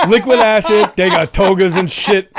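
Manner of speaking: high, very loud mock hype; hyped-up shout. Repeating Joey's togas line for laughs, hyped-up shout. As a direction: belt it out like an excited bro.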